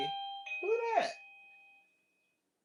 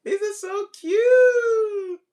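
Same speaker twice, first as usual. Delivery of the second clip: high, slow, near-crying gush; teary delight; 11 semitones higher, 9 dB louder, much slower than usual. Real, not acted. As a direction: gush over them, voice breaking. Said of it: "I'm so excited".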